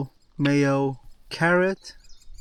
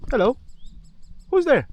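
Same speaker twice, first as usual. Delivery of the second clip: high, loud call to a dog; 12 semitones higher, 6 dB louder, faster than usual. Real, not acted. Calling out to a dog.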